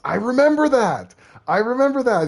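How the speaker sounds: high, loud excited recognition. Real, not acted. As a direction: blurt it out, thrilled to recall. Suddenly recalls the news story.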